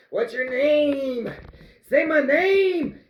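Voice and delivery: hammed-up challenge to a bully; mock confrontation.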